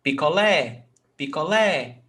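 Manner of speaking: picolé with LÉ leaning out at the end; pi-co-LÉ, landing on the final syllable; stress on last syllable. Shown as a demonstration. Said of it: "Lé is the stressed syllable".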